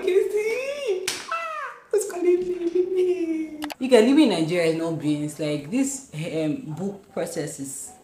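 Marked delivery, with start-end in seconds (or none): 0.0-3.6 very high, slow, stretched-out squeal; squealed plea. Retold or imitated. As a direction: wail it up high in mock despair. Retelling her plea to Casey.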